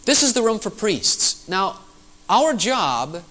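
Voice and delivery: ordinary delivery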